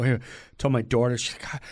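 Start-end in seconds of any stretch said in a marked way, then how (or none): none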